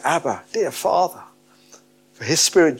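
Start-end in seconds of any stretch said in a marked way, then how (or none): 0.0-1.2 understated cry to a father; heartfelt cry. Acted. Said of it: "And we cry out".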